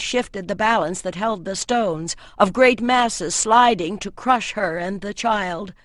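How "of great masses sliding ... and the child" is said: plain reading voice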